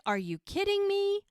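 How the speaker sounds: high-pitched mock outrage; imitated high incredulous protest; acted incredulity. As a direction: exclaim in exasperated disbelief. Acted disbelief as rain starts.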